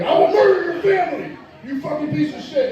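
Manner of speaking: high, hammed-up screaming threat, laughing; mock scream. Screaming at the student driver through the window. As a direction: scream it at him, half laughing. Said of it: "I'm screaming at this fucking dude".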